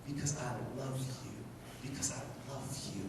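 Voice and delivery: soft devoted murmur; hushed tender. Acted. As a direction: breathe it like a secret of love. Hushed repetition after amen.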